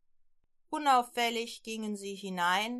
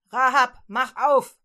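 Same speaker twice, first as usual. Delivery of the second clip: loud barked order at the door; shouting guards; 3 semitones higher, 13 dB louder than usual. Acted. Guards at night shouting at Rahab through the door, loud. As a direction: bang on the door and yell.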